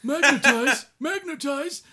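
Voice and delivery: high, loud alien pilot cry; Nemoidian voice. Retold or imitated. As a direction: shout it like a nervous alien pilot. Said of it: "the one in episode three who's flying Grievous's ship and says".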